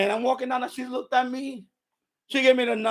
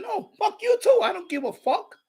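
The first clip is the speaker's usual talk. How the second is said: high-pitched cursing back; heated mock-argument retort; retold angry comeback; 9 semitones higher than usual. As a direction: fire back at her, voice jumping up. Retold cursing match with neighbor.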